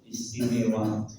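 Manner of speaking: ordinary talk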